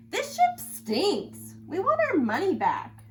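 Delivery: angry passengers shout at the cruise crew. A narrator's reading. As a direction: demand your money back, fed up.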